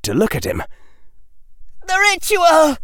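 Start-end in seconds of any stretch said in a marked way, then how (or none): 1.9-2.8 high-pitched anguished cry; frightened high wail; horrified cry. A narrator's reading. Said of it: "cried Harry Potter".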